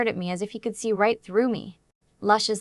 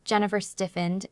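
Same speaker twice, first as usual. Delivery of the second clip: ordinary delivery, nothing marked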